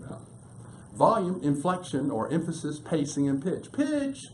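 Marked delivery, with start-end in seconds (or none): 3.7-4.3 suddenly pitched way up high. Shown as a demonstration. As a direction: sing out the word high to illustrate pitch. Teacher illustrates pitch with voice.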